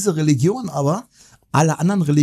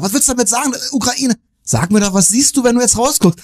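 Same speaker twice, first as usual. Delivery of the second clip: pressing question; 7 semitones higher, 4 dB louder than usual. Retold or imitated. Retold phone quarrel, caller pressed.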